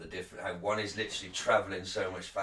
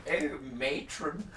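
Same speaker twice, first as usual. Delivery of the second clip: slow camp "oh, matron"; drawn-out Carry On style; hammed-up comic matron line; Carry On innuendo; 4 semitones higher, slower than usual. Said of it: "Carry On catchphrase".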